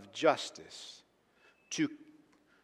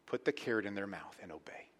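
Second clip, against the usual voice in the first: whisper; 5 semitones lower, 10 dB quieter than usual. Real, not acted. Quiet aside on obeying.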